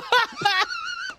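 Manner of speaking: a high burst of real laughter; laughing hard at his daughter's question; big laugh. Real, not acted. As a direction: break into a helpless laugh. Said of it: "I laughed so hard".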